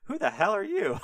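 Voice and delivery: high-pitched comic bewilderment; baffled Isaac. Retold or imitated. Blind father to his son, baffled. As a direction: blurt it out high, not knowing who this is.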